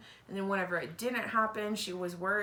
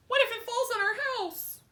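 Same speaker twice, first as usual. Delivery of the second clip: put-on much higher, anxious kid voice; worried child; 13 semitones higher, 3 dB louder than usual. Wimberly fearing the tree, high worried child voice. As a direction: fret like a small child.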